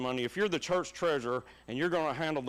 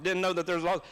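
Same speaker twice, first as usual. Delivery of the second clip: ordinary talk, nothing marked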